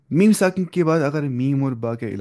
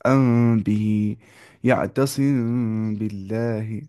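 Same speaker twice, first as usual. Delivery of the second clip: slow demo recitation; much slower than usual. Shown as a demonstration. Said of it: "apply करना है ये वाला".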